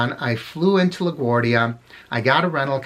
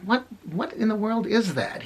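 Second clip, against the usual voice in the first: higher, softer voice of puzzled disbelief; a friend's bewildered double take, retold; puzzled thought; 8 semitones higher, 5 dB quieter than usual. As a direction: act out his baffled thought, high and hushed. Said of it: "And he thought".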